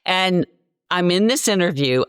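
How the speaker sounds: ordinary delivery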